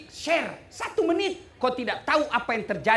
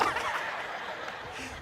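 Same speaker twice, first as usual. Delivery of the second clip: breathy laugh; 10 dB quieter than usual. Real, not acted. Laugh at joke.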